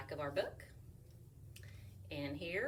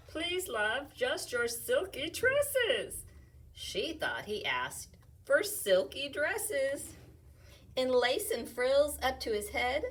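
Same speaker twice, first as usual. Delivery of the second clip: lively read-aloud, louder as it builds; reading to children; 4 semitones higher, 7 dB louder, slower than usual. Acted. Teacher reading a rhyming picture book aloud. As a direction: bounce through the rhymes for kids.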